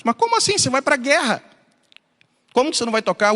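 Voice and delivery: high-pitched indignant retort, acted out; indignant disbelief. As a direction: imitate an outraged, incredulous challenge. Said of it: "Os amigos ficaram com raiva dele".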